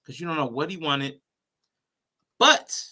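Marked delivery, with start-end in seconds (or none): 2.4-2.8 sudden loud interjection. Real, not acted.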